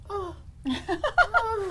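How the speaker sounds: high mock moan; imitated moaning. Agent retelling sounds heard down the hallway, mock moaning. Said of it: "I progressively hear moaning".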